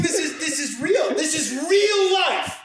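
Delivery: mock-grand marvelling shout; amazed exclamation. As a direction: proclaim it like a revelation. Retelling his own amazed exclamation.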